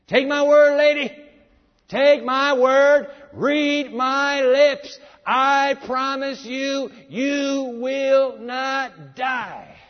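loud, high, very slow insistent promise; forceful serpent. Acted. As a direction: drag out every word like a con man swearing. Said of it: "The serpent's language is as forceful".

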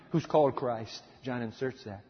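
usual speaking voice

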